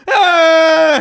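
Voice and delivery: loud, high imitated kid's wail; hammed-up crying shriek; mock crying wail. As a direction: burst out bawling like a small child. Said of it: "just starts crying".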